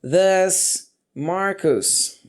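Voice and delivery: very slow, drawn-out question openers; slow drill. Shown as a demonstration. Teacher drilling question starters, very slow. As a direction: stretch each Does for the class. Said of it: "Então, ficaria".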